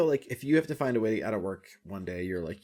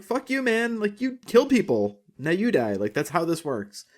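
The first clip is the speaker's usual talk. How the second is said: much higher, heated mock telling-off; mock angry retort; 9 semitones higher, 4 dB louder than usual. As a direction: snap back at the killer, hammed-up. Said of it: "And you're like".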